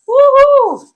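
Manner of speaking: big high loud woohoo; excited whoop. Shown as a demonstration. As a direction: whoop with pure delight. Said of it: "Can we all do that together?".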